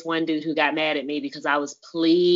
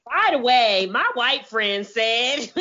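mock announcement; 10 semitones higher, 4 dB louder, slower than usual. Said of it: "be like".

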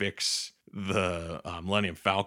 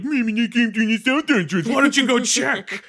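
much higher, louder sarcastic parroting; mocking repeat; 9 dB louder than usual. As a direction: throw their words back at them, annoyed. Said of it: "he's getting pissed off at everybody".

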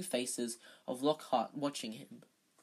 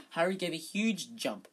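ordinary delivery

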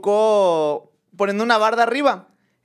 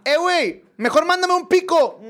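high-pitched holler for a tool; calling down; 10 semitones higher than usual. Retold or imitated. Master builder asking his helper for a pickaxe, calling out. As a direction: holler it to someone below. Maestro llama al chalán abajo.

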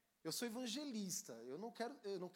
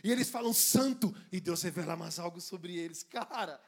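much louder, building fervor; preaching at full force; 13 dB louder than usual. Acted. Preacher climaxing on God's endless revelation.

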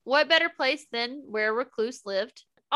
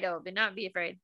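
dropped low and softer, a quiet counter-voice; softer waffling reply; 5 semitones lower, 7 dB quieter, much faster than usual. Retold or imitated. Mocking George's waffling to co-host, lower and softer. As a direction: let it fall away quietly, the other side of the dilemma. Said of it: "Waffling back and forth".

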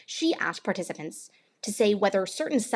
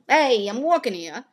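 put-on loud, drawn-out New York accent; New Yorker; 4 semitones higher, 6 dB louder than usual. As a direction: bellow it like a movie New Yorker. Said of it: "media representations of New Yorkers".

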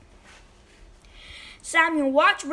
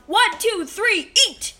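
raised, higher starting count; judge yelling; 6 semitones higher, 5 dB louder, slower than usual. A narrator's reading. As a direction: call the start of a race. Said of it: "The judge yelled".

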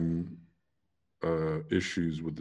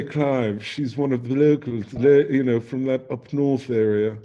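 higher, louder posh English introduction; mock posh host; 4 semitones higher, 5 dB louder than usual. Host introduces the northern guest to Clive in mock posh English. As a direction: present her like a stuffy posh English host. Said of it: "I say".

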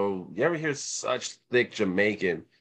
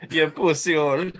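loud, high mock Jamaican insult; 6 semitones higher, 5 dB louder than usual. Comic imitating Jamaicans' swearing, hammed-up Jamaican accent. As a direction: exclaim it in a put-on Jamaican accent. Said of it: "the best thing that Jamaicans ever contributed".

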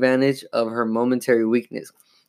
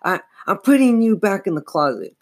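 high-pitched frightened insistence; stammer, then insist; raised, urgent warning; insistent; 8 semitones higher than usual. A narrator's reading. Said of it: "Chris insisted".

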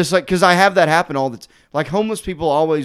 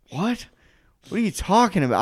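high-pitched baffled question; retold bafflement; 5 semitones higher, 4 dB quieter than usual. Doorman answers a snitching accusation, baffled. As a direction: go up high, mildly incredulous.

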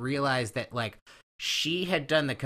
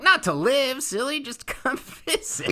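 put-on heavy Texas accent; 13 semitones higher, 4 dB louder than usual. Kim inviting him to Paris, Texas, in a hammed-up Texas accent. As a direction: lay the Texas accent on thick. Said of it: "she does the heaviest accent".

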